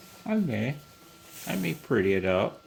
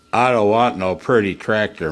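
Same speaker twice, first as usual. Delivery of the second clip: loud, mock-gruff refrain; hammed-up quote; 7 dB louder than usual. Man at his workbench quoting a stubborn farmer, loud and gruff. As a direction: bark it like a stubborn old farmer. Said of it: "mock cowboy song line".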